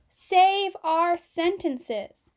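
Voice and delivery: slow loud mock SOS; distress call. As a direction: call for rescue slowly.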